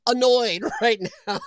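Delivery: laughing through the words; real giggle mid-line. Real, not acted. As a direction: chuckle it out while admitting annoyance. Laughing at own annoyance.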